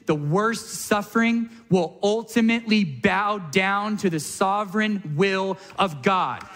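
usual speaking voice

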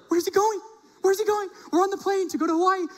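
high, alarmed wife voice; imagined wife's alarm. Retold or imitated. Wife on the plane wondering where he ran, high and alarmed. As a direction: panicky high-pitched wife. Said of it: "maybe Mandy's probably like".

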